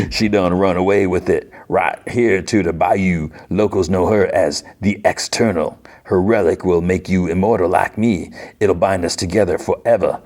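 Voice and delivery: bad put-on Cajun accent; hammy Cajun storyteller; Gambit. Gambit recounts Apocalypse's old love, badly faked Cajun accent. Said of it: "the worst Gambit accent ever".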